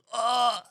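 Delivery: high hammed-up dying gasp; overacted death scene. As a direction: gasp out a melodramatic last breath. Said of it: "and then dramatic death scene".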